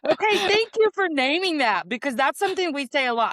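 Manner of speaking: high, loud burst of recognition; delighted agreement. Real, not acted. Guest thanks the host for naming that, delighted and loud. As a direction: jump in fast and high, thrilled to hear it said.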